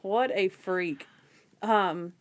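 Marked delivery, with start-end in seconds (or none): 0.0-1.1 slow, deliberate, word-by-word verdict; drawn-out, deliberate amused judgment; slow emphatic repeat. Real, not acted. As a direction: space out WHAT, A, FREAK.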